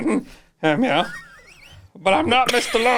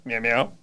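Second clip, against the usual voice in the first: low soft cat meows; 11 semitones lower, 5 dB quieter than usual. Pretend. Cat noises tacked onto lines.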